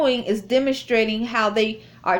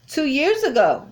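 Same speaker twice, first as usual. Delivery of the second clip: higher-pitched incredulous echo; retold surprise; 6 semitones higher than usual. Cashier told of visits two years ago, retold surprise. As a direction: re-enact disbelief, pitched up. Disbelief at being remembered.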